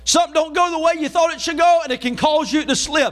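driving, high-pitched sermon delivery; Southern revival preacher in full flow; full-force preaching. Real, not acted. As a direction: preach it with rising intensity.

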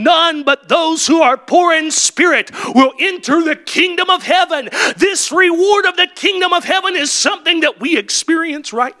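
high, shouted preacher's call; full-force preaching. Acted. Preacher proclaiming the kingdom to the church. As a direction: lift it high and ring out.

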